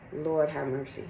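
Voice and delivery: ordinary talk, nothing marked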